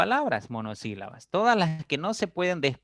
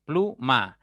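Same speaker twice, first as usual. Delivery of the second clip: "Pluma" split into plu - ma; two separate beats in "Pluma", plu, then ma; syllable by syllable; 3 dB louder than usual. Shown as a demonstration. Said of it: "Plu es tónica y ma es átona".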